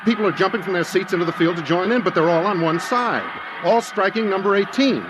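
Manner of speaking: high, urgent play-by-play, climbing in alarm; an announcer losing his composure as the crowd attacks; horrified commentary. Acted. Johnny Scott describing the mob beating number eighteen, horrified and urgent. Broadcast turns to horror.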